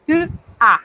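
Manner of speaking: Tu with lips tightly rounded, then a without the S; French tu as. Shown as a demonstration. Teacher showing tu as to students, closed U. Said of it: "Y esa U".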